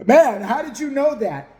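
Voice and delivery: loud, slow, astonished client; mock amazement. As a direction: marvel loudly, stretching the words. Acting out amazed celebrity client.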